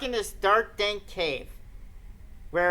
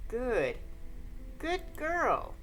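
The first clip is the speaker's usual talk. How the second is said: put-on creepy ghost child; 2 semitones higher, 9 dB quieter, much slower than usual. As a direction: coo it like a sinister child. Sachiko voiced, creepy praise.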